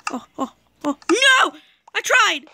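shocked no, then protest; dismayed cry. Real, not acted. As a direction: wail at losing. Falls off in game.

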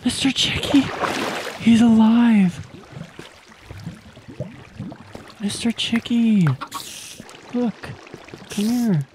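high squealing joy at finding a pet; overjoyed squeal. Real, not acted. Player finds her chicken alive, squealing with joy. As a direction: squeal his name like a long-lost friend.

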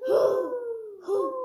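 loud high hooting; owl hoots. Pretend. Child asked to try, loud owl hoots. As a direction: give a big owl hoot. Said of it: "How about you?".

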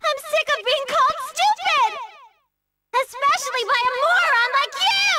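high, pouting protest at being called stupid; indignant shouting. Acted. Girl yelling at the rival who keeps calling her stupid, indignant shout. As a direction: fire it back, offended and shrill with hurt pride. Insulted character yelling back.

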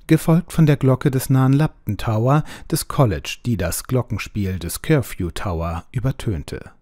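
plain reading voice

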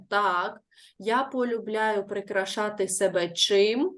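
ordinary talk, nothing marked

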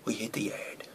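near-whisper, barely voiced; very soft, holding the tension; hushed suspense. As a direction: drop almost to a whisper. Suspense before walking into danger.

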